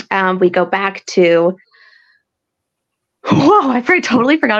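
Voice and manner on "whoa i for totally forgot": startled, high-pitched whoa; real surprise, jumping up in pitch; sudden realization. Real, not acted. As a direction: catch yourself as you suddenly remember. Suddenly remembers a forgotten storyline.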